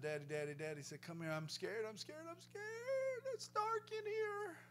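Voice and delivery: put-on high, small frightened child's voice; a frightened boy calling for his father; scared little boy. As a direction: plead like a scared child in the dark. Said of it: "One little boy who was scared said".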